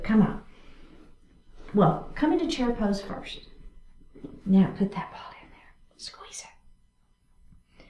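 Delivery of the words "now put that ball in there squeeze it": soft whispered cues. Real, not acted. Instructor guiding class into chair pose, whispered. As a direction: murmur the setup to the class.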